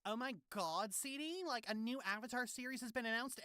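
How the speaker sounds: put-on high, soft gossipy girl; gossip voice. Shown as a demonstration. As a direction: gush like a gossiping teen. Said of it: "Got the gossip voice down so well".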